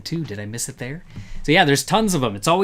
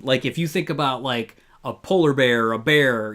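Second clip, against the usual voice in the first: usual speaking voice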